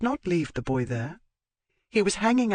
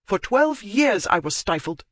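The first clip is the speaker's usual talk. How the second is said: higher and louder, indignant tirade; furious retort; 10 semitones higher, 5 dB louder than usual. Acted. Zoe berating Marchmont for making a scene, raised and furious. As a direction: lash back at him, voice raised.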